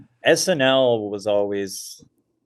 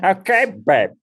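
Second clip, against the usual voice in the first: high, put-on comedian's catchphrase; Dennis Miller; 9 semitones higher, 4 dB louder than usual. Comic echoing his friend's impression, high mock patter. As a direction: copy another comic's impression, pitched way up. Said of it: "My impression of Dennis Miller is Dana doing Dennis Miller".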